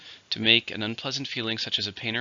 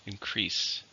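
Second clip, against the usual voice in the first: "Increase" with the stress on "crease"; second-syllable stress; 7 dB quieter than usual. Shown as a demonstration. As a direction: model "Increase" carefully, weight on the second syllable. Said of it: "stress in the second".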